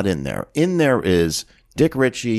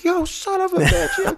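very high, drawn-out squawk of betrayal; mock outrage. Producer realizing his assistant betrayed him, hammed-up outrage. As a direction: play up the outrage, slow and high.

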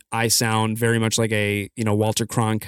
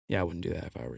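low, hushed aside; mock-discreet undertone; quiet warning; 7 semitones lower, 14 dB quieter, much faster than usual. Retelling a quiet mid-play warning.

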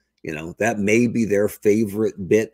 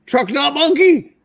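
drawn-out, high and loud mock slogan; mock outcry; 14 semitones higher, 5 dB louder than usual. Co-host repeats the fan slogan for effect, exaggerated mock outcry. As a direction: stretch it into a high heckling yell.

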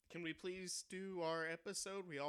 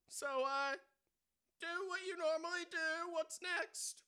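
loud, high robber yell; frantic shouting hostage taker; 13 semitones higher, 7 dB louder, slower than usual. Acted. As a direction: bark it like a stick-up man. Robber after shooting.

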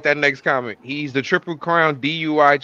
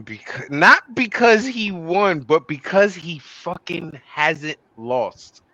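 ordinary talk

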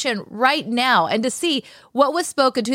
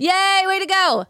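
excited cheer; 8 semitones higher, slower than usual. Real, not acted. Cheering the truckers' protest.

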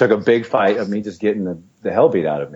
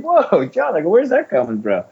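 mock surprised protest; 10 semitones higher than usual. Reacting to John's renewed taunt.

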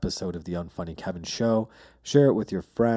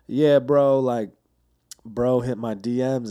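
imitating a giggly thick Atlanta accent, higher and louder; 4 semitones higher, 7 dB louder, slower than usual. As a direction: do the rapper's thick Atlanta drawl, amused. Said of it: "he has this thick Atlanta accent".

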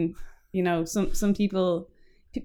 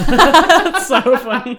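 slow and laughing, louder; real laughter at the story; cracking up at the friend's story; 4 semitones higher, 6 dB louder, slower than usual. Real, not acted. Reacting to a funny story.

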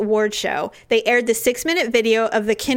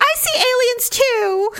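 mock believer voice; 13 semitones higher, slower than usual. Kind fans replying on social media.

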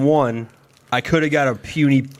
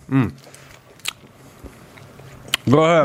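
eating sour candy; faster than usual. Real, not acted. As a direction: mouth full of sour candy. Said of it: "Not too bad?".

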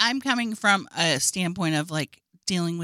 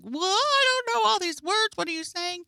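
put-on much higher, puzzled kid voice; confused child voice; 14 semitones higher than usual. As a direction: play an overwhelmed child. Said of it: "and then just like".

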